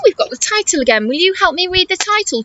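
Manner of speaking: usual speaking voice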